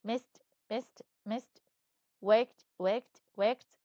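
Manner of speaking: talked, hopped, laughed, liked, missed, waked, each repeated, ending on a crisp t; t ending drill. Shown as a demonstration. Teacher drilling past verbs with the t ending, crisp. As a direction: model the t sound, word by word. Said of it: "خلينا نرجع نقرأهم".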